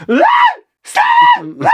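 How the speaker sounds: put-on high, loud child screaming stop; mock screaming kid. Seven-year-old son screaming at the stabbing, mock shriek. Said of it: "he's just".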